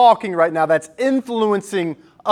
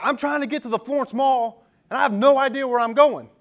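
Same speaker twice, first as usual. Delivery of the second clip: flustered lady; 7 semitones higher than usual. Retold or imitated. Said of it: "And she's like".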